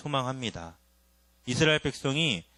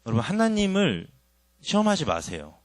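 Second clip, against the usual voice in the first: urgent warning; 5 semitones higher, 4 dB louder than usual. Real, not acted.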